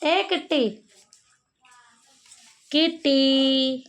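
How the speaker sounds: high, drawn-out calling of the cat's name; coaxing cat call. Real, not acted. Woman coaxing the stray cat to come closer, high sing-song call.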